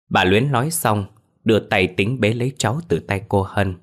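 plain reading voice

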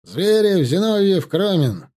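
loud, high roll call; roll call shout. A narrator's reading. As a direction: bellow each name across the square. Roll call on parade square.

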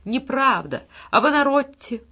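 high, loud outburst rising on the second cry; furious, desperate cry. A narrator's reading. Salieri, perishing, fights the thought, furious desperate cry. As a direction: cry it out like a man going under. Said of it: "с яростью, с отчаянием погибающего Сальери кричит".

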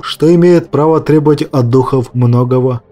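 usual speaking voice

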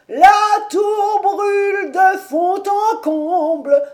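high, loud, slow wailing lament; broken breathless cry. A narrator's reading. Old servant crying out to his master the tower burns, high broken wail. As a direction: cry out in broken gasps of despair. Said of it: "on l'entendit crier d'une voix entrecoupée".

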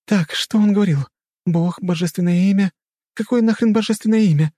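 put-on high-pitched agitated young woman; high, heated muttering-free rant; frantic heroine. A narrator's reading. Heroine's frantic brainstorming in story.

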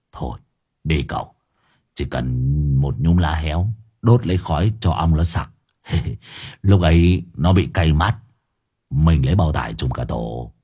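low-pitched character laying out a plan; Hải Nghẹo, persuading. A narrator's reading.

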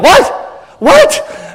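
high-pitched, incredulous "what?"; shocked disbelief. Retold or imitated. Friends stunned the pastor turns down a drink, shocked. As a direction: imitate someone squeaking in shock. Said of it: "then they're shocked".

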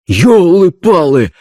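loud, slow startled soldier's cry; startled exclamation. Acted. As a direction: blurt it out in shock. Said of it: "закричал солдат". (громко и ошарашенно, как солдат)

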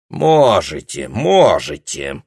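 slow, loud threatening growl; menacing. A narrator's reading. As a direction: drawl it out as a threat. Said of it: "с угрозой сказал Хаббикер". (угрожающе, медленно и громко)

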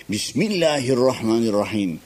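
ordinary talk

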